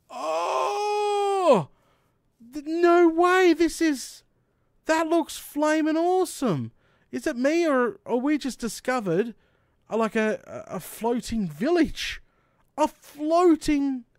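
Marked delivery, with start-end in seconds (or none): 0.1-9.3 high, loud amazed shouting; thrilled discovery, voice up high; excited. Real, not acted. Discovers floating village. 12.8-14.0 amazed. Real, not acted. Amazed repeat of discovery.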